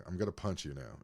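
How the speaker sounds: ordinary talk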